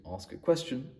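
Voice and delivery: "ask a question" lifting up at the end; rising ending. Shown as a demonstration. Trainer showing clients how tone asks a question, rising ending. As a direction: end "question" on an upward tone, like asking. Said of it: "going up at the end of a sentence".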